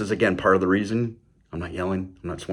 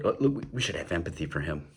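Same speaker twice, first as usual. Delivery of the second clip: soft, fast, much quieter mock-caring murmur; mock pious sympathy; 4 semitones lower, 9 dB quieter, faster than usual. Mocking liberals, a hushed, quick fake-caring voice. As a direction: rattle it off softly like a smug do-gooder.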